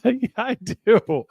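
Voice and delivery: slow, laughing admission; barely holding in a laugh. Retold or imitated. Admitting to his friend he needs help, laughing. Laughing while retelling own answer.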